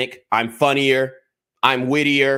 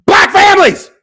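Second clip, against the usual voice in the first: emotional outburst; 12 dB louder than usual. Real, not acted. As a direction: voice jumps high and loud, cracking with feeling. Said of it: "this is why I'm so emotional about this".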